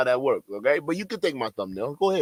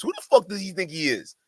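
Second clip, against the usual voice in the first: ordinary talk, nothing marked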